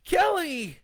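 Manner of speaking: high, loud anguished shout; husband shouting at his attacking wife; desperate yell. A narrator's reading. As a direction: cry out her name.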